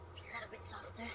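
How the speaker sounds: very soft, voiceless whisper. Real, not acted. Cook showing viewers the softened food, whispered. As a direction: drop right down into a whisper.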